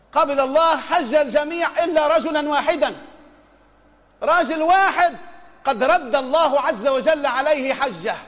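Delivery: ordinary talk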